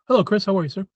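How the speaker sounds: ordinary talk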